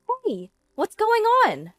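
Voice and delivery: very high, loud mistress voice; shrieking. A narrator's reading. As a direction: shriek from the end of the line. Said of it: "a woman's voice suddenly shrieks".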